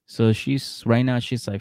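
usual speaking voice

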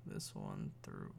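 low, slow, deep voice. Real, not acted. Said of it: "my voice hasn't been that deep in ages".

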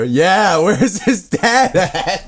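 high, laughing through the words. Acted. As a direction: giggle while asking. Laughing in character.

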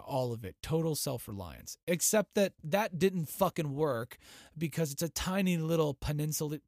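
ordinary talk

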